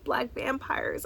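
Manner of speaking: ordinary talk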